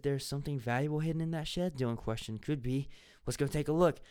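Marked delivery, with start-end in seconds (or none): none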